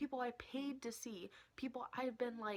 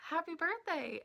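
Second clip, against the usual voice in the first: high, loud, cheery well-wishing; imitating friendly congratulations; 7 semitones higher, 6 dB louder than usual. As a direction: act out a nice guy's greeting. Singer's greeting acted out.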